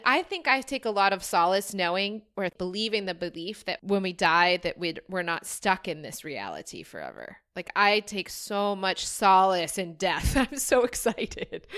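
ordinary talk

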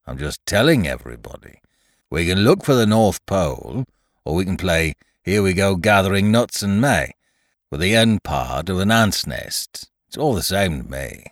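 gloomy storybook donkey, weary of it all; Eeyore, gloomy. Acted. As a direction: grumble on with glum indifference.

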